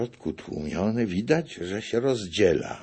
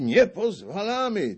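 much higher, Nie stressed; higher shouted veto; 13 semitones higher, 5 dB louder than usual. A narrator's reading. The narrator echoes the veto, much higher. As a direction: mimic the crowd's veto. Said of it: "ci krzyczą".

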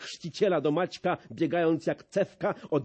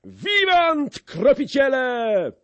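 much higher, loud, slow cheer; crowd cheer; 9 semitones higher, 7 dB louder, slower than usual. A narrator's reading. The gentry answer the sprinkler, a loud high cheer. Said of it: "Szlachta odpowiedziała".

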